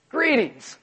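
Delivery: high-starting, falling, mild hello; understated greeting. Acted. Risen Jesus appearing to followers, comically understated greeting. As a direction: say it as if nothing happened. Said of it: "a little bit of an understatement".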